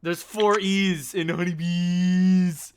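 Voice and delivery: high, slow, loud holler; gleefully drawn-out yell; shouting like a moron. Acted. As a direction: stretch out "honeybees" at full volume. Keeps up the moronic shouting bit.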